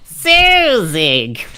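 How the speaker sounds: squeaky high mock voice; silly high voice. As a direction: pitch it way up, goofy. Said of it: "You're not gonna give him, like, a".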